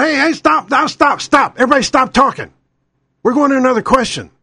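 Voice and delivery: high-pitched, urgent moderator's interruption; Keith Olbermann. Acted. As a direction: cut in frantically to halt a debate. Acts moderator breaking up a debate.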